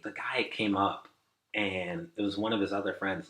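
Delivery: ordinary talk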